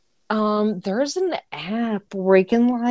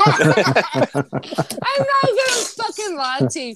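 laughing, slow and higher; 8 semitones higher, slower than usual. Real, not acted. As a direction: admit it through laughter. Laughing confession.

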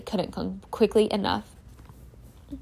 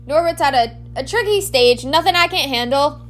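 a hint of Hagrid, higher and louder; 5 semitones higher, 4 dB louder than usual. Hagrid at his window telling the children to stay out, lightly voiced. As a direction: brush them off, lightly voiced.

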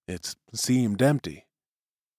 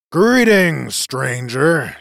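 hearty hail; 3 semitones higher, 6 dB louder, much slower than usual. A narrator's reading. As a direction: call out a greeting to another boat. Captain hailing a visiting boat.

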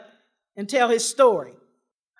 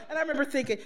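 ordinary talk, nothing marked

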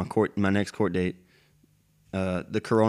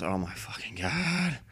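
slow, soft, crushed groan of disbelief; despairing mutter; 9 dB quieter, slower than usual. Retold or imitated. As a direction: let it sink in, drawn out under breath. Retelling his reaction to arrest.

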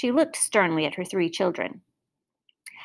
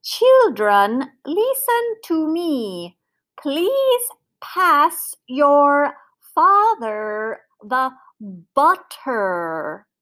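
very slow, high, artificial enunciation; high, unnatural voice; 5 semitones higher, much slower than usual. A narrator's reading. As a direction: pipe each word out separately, stiffly over-correct. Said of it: "said in a high, unnatural voice".

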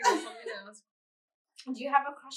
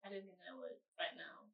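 a soft, breathy whisper; 6 semitones lower, 16 dB quieter than usual. Real, not acted. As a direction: drop to a secret whisper. Private aside on surgery.

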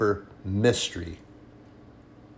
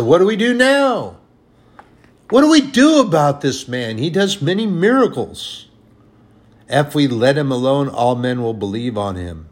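higher, louder worried voice; panicked Pharisees; 5 semitones higher, 7 dB louder than usual. Retold or imitated. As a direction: fret about losing their place. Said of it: "took counsel with them and said".

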